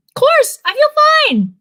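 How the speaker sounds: very high, bright fake-fine reply; forced cheerful. A narrator's reading. Daughter assures her mom, forced cheerful. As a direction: sing it out to hide the worry. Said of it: "which isn't a lie".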